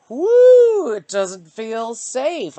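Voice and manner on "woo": sudden high whoop of nerves; big nervous whoo; loud woo. Real, not acted. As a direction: let out a loud startled woo. Nervous outburst about fear.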